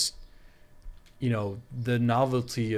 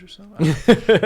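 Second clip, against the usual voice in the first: laughing; 10 semitones higher, 15 dB louder than usual. Real, not acted. Self-mocking joke about rare uploads.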